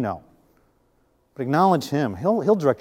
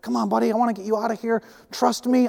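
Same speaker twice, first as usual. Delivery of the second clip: coaxing; 6 semitones higher than usual. Retold or imitated. Coaxing a bird in the house.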